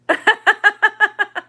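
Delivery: high, loud burst of laughter; real rapid giggle, pitched well up; laughing. Real, not acted. Teacher amused by a chat comment, laughing.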